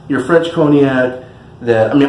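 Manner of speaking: ordinary talk, nothing marked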